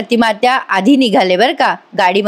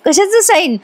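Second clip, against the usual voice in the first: puzzled surprise; 8 semitones higher than usual. Acted. Friend puzzled by sign talk.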